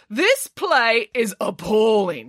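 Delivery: slow, scornful pig voice; denouncing. A narrator's reading. Nanny Piggins condemns the nativity play, slow and scornful. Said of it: "she denounced".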